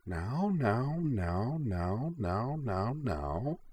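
soft, high, placating now-nows; calm them down gently, like settling a crowd; hushed, soothing repetition; gentle soothing. A narrator's reading. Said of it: "said gently".

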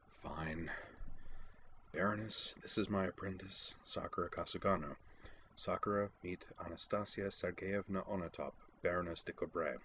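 soft grudging introduction, a touch dry; muttered, mildly sarcastic. A narrator's reading. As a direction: introduce them under your breath, lightly wry. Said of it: "muttered with only mild sarcasm".

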